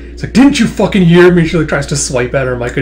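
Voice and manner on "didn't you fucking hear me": loud, high angry demand; acted fury. Warlord swiping at the niece, angry shout. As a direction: lash out with a raised voice.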